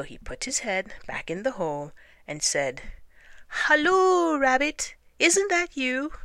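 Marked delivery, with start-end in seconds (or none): 3.5-6.1 high, drawn-out halloo; calling out. A narrator's reading. Pooh calls to Rabbit in the hole, high call.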